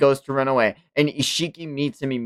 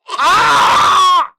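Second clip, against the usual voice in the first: loud villain cry; 10 dB louder than usual. Acted. Villain cries out in battle.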